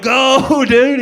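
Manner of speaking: high, loud urgent yell; yelling to a buddy. Retold or imitated. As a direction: shout it while running for the car. Fleeing scene, yelling to buddy.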